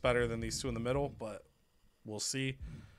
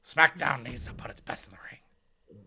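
whisper. Acted. Wrestler whispering complaint.

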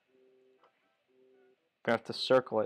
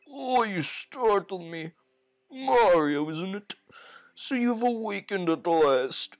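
put-on slow, a bit higher old man; lightly voiced old grandfather; 4 semitones higher, slower than usual. Grandfather greeting Mario, lightly voiced old man. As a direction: speak like a slow elderly Goomba.

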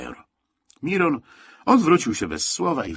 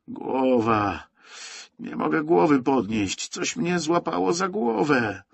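panicky; 7 semitones higher, slower than usual. A narrator's reading. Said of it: "W głosie górala słychać było panikę".

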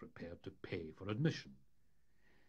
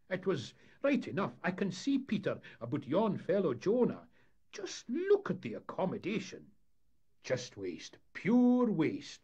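louder, higher Scots storyteller's character voice; scornful skipper; 11 semitones higher, 8 dB louder than usual. Acted. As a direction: play a skipper loudly lamenting the waste.

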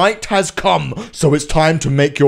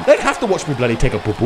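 usual speaking voice

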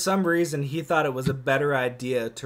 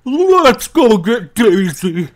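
monster voice; 3 semitones higher, 12 dB louder than usual. Acted. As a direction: boom it like a goofy monster. Monster speaks.

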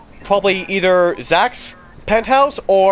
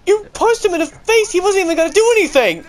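shocked yelling; 12 semitones higher than usual. Real, not acted. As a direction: yell it in sudden disbelief. Shocked outburst at a teammate.

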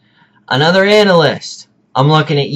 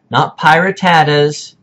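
ordinary talk

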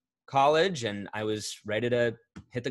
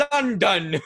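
higher, hammed-up British "London"; terrible British accent; 9 semitones higher, 6 dB louder than usual. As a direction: answer proudly in a bad British accent. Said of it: "And I'd be like".

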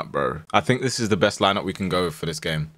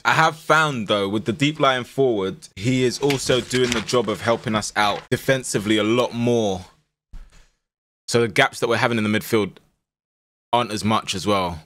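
ordinary talk

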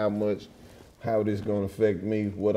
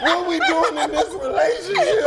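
mock wounded partner. Mocking exaggerated hurt partner.

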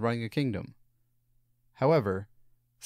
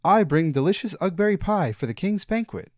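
higher, louder call to the gate; yelled offer; 8 semitones higher, 5 dB louder than usual. A narrator's reading. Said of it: "yelled to the gatekeeper".

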